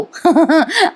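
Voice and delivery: high rippling laugh; amused chuckle. Real, not acted. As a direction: laugh lightly at the poor husband. Narrator chuckles at obedient husband.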